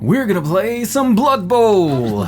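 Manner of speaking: high, loud, slow announcer boom. Acted. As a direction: stretch it like an arena announcer. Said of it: "That was pretty good announcer voice".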